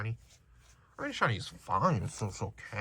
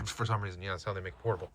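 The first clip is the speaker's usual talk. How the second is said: ordinary delivery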